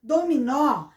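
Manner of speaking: Dominó repeated loudly, leaning on the last syllable; stress on final NÓ. Shown as a demonstration. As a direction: stress the open ó ending Dominó. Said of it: "a sílaba forte no nó". (Dominó com a sílaba final nó bem forte)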